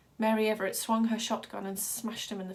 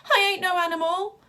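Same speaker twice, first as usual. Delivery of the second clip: much higher, louder panicked yell; 10 semitones higher, 9 dB louder than usual. A narrator's reading. Wild Boy yelling at the ringmaster holding a shotgun. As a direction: scream it at the gun. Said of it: "he yelled".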